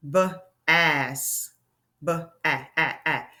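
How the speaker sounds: BAS broken up, short A repeated; B, then short "a-a-a" in separate bursts; sounding out. Shown as a demonstration. Teacher showing a short vowel sound, sounding it out. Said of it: "That's a short vowel sound".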